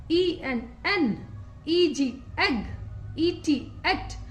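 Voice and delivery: letters spelled very slowly; drawn-out letter-by-letter spelling. Shown as a demonstration. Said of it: "हम शुरुआत करते हैं vowel ई से".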